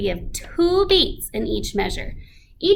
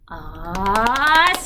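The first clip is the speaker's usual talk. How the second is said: crescendo then decrescendo; 2 semitones lower, slower than usual. Shown as a demonstration. Teacher demonstrates dynamics on Australia, swelling then fading. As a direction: swell Australia up and let it fade. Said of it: "pat on your legs for your crescendo, decrescendo".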